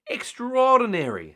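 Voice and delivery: very high, loud awed cry; gasping in awe. A narrator's reading. Missus Grinling amazed by the surfer, very high awed cry. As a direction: gasp it out in amazement. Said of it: "she gasped".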